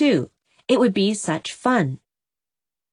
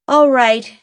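loud, higher, defiant outburst; 6 semitones higher, 7 dB louder than usual. A narrator's reading. Jem to his mother, snubbing his aunt, loud defiant retort. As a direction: fling it out in challenge. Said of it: "he said defiantly".

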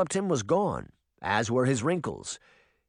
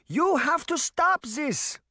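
higher, slow, urgent yelling granny; slower than usual. A narrator's reading. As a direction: yell at him to stop, slowly. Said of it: "Granny yelled".

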